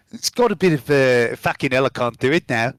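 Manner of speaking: normal talking voice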